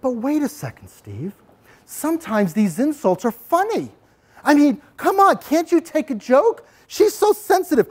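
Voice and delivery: mock student objection. Student telling Steve the insults are only funny, whining. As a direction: whine as a kid who thinks it's just a joke. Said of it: "Now you might say".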